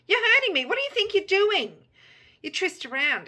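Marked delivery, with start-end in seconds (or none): 0.1-1.9 high, loud, outraged cry; loud, high-pitched shriek of protest; screaming out. A narrator's reading. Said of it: "You scream out".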